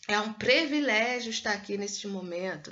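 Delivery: "privilégio" said "previlégio" in a formal phrase; mock-formal line with "privilégio" as "previlégio"; mispronunciation in a sample sentence. Shown as a demonstration. Teacher acting out a speech that says "previlégio". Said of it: "A gente falando privilégio".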